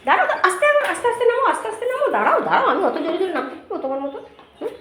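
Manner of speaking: calling to the dog. Real, not acted. Coaxing dog down the stairs.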